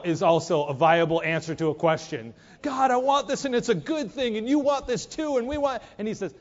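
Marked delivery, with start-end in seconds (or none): 2.6-5.8 eager rushed pleading. Acted.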